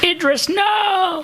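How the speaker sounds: high, slow hammed-up wail of despair; mock anguished cry. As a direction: wail it like a heartbroken fan, overdone. Mock dramatic cry at news.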